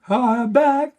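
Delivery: high, loud, excited announcement; gleeful re-entry. Retold or imitated. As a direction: burst back in, loud and high. Acting out popping out the other side.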